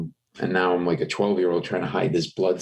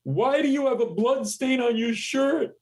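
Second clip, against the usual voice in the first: outraged high-pitched imitation; retold indignation, pitched way up; mock angry boss. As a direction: demand an explanation as the senior lawyer. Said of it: "he was really pissed".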